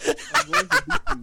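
playful squealed threat; laughing shout. Real, not acted. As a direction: shriek it through laughter.

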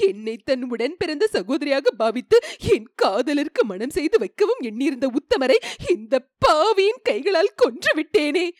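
high, wailing self-reproach; sobbing lament. A narrator's reading. Manimekalai sobbing over having killed the prince. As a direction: cry out in grief and guilt. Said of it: "என்று சொல்லி விம்மினாள்".

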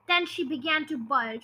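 ordinary delivery

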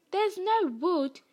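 high, slow mimicking whine; drawn-out, scornful echo; mocking imitation. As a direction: mimic a friend's silly protest. Ron mimics Hermione's earlier cry.

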